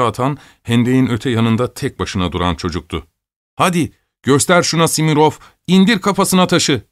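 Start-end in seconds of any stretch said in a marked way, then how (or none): 3.6-6.8 put-on raised, higher-pitched boys' shouting; excited kids cheering on a throw; egging-on shout. A narrator's reading. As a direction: call out to spur a friend on. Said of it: "diye bağırıştılar".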